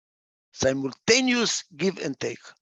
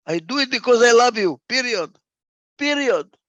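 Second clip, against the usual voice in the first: high, louder, insistent on PERIOD; emphatic declaration; 7 semitones higher, 7 dB louder than usual. Acted. Speaker rejects keeping accounts in love, emphatic declaration.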